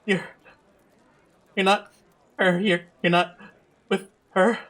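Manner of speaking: high, halting, mildly panicked stammer; frightened, gasping. Acted. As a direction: struggle to get the words out in fear. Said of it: "gasps out in fear".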